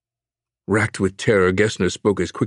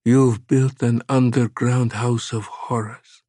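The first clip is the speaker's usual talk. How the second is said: ordinary delivery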